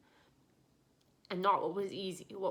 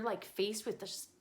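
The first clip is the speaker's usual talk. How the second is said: usual speaking voice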